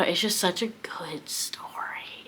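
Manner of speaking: slow, breathless whisper of awe; hushed whisper. Real, not acted. Reader gushing to viewers about a beloved book, whispered. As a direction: whisper it like a cherished secret.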